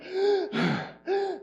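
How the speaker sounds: sharp high gasp for air; panicked gasp. Retold or imitated. Pastor reliving the costume panic, a sharp gasp. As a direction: suck in air like you're suffocating. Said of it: "and I'm like".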